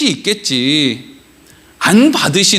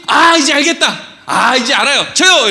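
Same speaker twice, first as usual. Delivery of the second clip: higher-pitched mock eureka; excited child; 3 semitones higher than usual. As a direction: imitate a kid eager to be picked. Retold child suddenly gets the hint.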